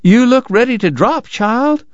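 doting wife greets the ragged traveler, high and fussing; fussing Southern lady. Acted. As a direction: coo over the sick boy in a high voice. Missus Segar frets over him.

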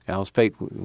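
usual speaking voice

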